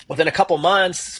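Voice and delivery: ordinary delivery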